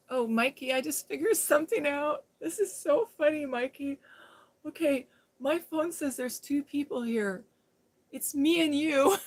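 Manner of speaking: amused excitement. Real, not acted. Telling Mikey the phone counts two people, giggling. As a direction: share a funny discovery, bubbling over. Amused discovery told to a viewer.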